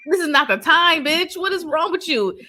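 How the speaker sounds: loud, high, acted scolding; mock cussing out. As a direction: chew her out, imitating the character. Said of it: "cussing her dumb ass out".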